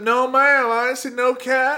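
high, loud caricature of old cartoon dialect; loud, high mock-servile cartoon dialect; Stepin Fetchit. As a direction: put on an exaggerated minstrel-era dialect. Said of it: "talking quite a while in that dialect".

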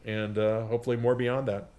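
normal talking voice

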